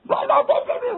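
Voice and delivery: very high mock sermon babble; loud preaching gibberish. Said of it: "Wih, kenceng". (ocehan khotbah yang tinggi dan keras)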